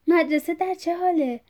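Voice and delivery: مدرسه said high and loud, eager; excited question. Acted. Father eagerly asks his son about مدرسه excitedly.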